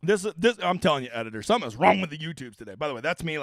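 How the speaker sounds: usual speaking voice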